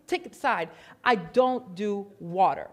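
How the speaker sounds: normal talking voice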